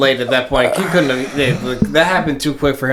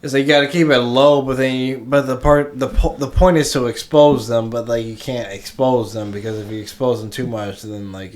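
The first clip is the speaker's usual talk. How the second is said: ordinary delivery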